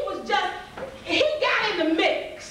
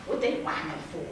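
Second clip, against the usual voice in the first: low, soft scornful mutter; mock dismissive; 12 semitones lower, 7 dB quieter than usual. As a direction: shrug it off under the breath. Sibling dismisses the crying.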